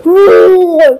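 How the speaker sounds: loud, drawn-out imitated roar; happy lion roar. Lion gets his roar back, loud happy roar. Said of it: "let out a happy roar".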